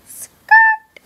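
high, loud screech like skidding tyres; squealed catchphrase. Pretend. Playful shouted tyre-screech catchphrase.

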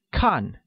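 看 with a clear fall in pitch. Shown as a demonstration. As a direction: drop the pitch through 看. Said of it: "pronounced with a falling tone".

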